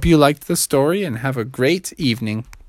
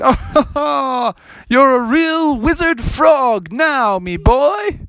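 put-on much higher, slow old wizard with a laugh; proud old wizard; 11 semitones higher, much slower than usual. Wizard praises Froggy.

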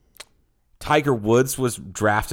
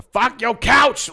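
shouting; 12 semitones higher, 4 dB louder, much slower than usual. Retold or imitated. Quoting Chappelle bit.

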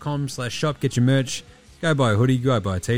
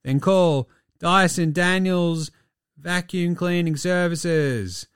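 slow, higher cheesy commercial voice; mock ad read; 5 semitones higher, slower than usual. Host parodying a cleaning services ad, slow cheesy pitch. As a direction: sell it like a cheap local TV ad.

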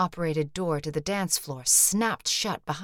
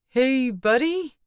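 slow, higher and louder call; 8 semitones higher, 7 dB louder, slower than usual. A narrator's reading. Brick calling into the empty hall for the man.

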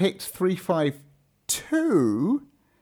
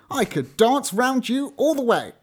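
trickster tale voices; 7 semitones higher than usual. Acted. Boastful hare in fable.

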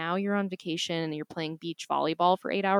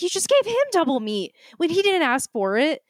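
indignant complaint; 8 semitones higher than usual. Retold or imitated. Retold complaint to server.

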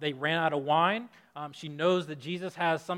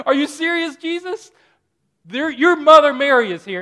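laugh, then higher and much louder; exasperated disbelief; 11 semitones higher, 17 dB louder than usual. Retold or imitated. Preacher voicing Mary's disbelief at Jesus, loud and exasperated. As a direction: burst out in mock exasperation.

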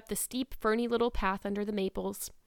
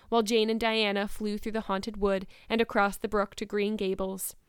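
ordinary delivery, nothing marked

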